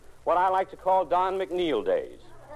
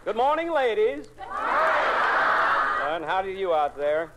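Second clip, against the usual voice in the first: hearty host greeting; 4 semitones higher, much slower than usual. Acted. Host greets radio audience.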